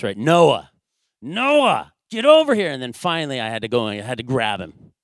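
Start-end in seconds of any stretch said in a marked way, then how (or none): 0.2-2.7 raised, high, loud call to a disobedient son; escalating exasperated father's shout; dad voice. Retold or imitated. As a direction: bellow like a frustrated dad calling his kid. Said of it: "you start getting the dad voice".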